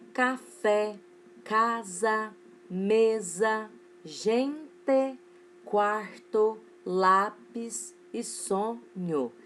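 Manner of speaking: "Café", "casa", "mesa" each split into two separate beats; syllable by syllable. Shown as a demonstration. Teacher showing third graders two-syllable words, syllable by syllable. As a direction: break "gente", "quarto", "lápis" and "sonho" into two spaced syllables. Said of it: "ao separar, elas têm duas sílabas".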